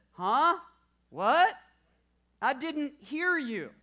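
loud, high "Huh? What?"; loud, high, slow "I didn't hear you"; deaf wife calling out. Retold or imitated. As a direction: play the half-deaf wife shouting back.

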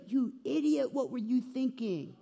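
high-pitched exasperated scolding; fed-up mom scolding her fighting kids; angry scolding mom. Retold or imitated. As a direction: snap at the kids like a fed-up mother. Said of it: "she would say things like".

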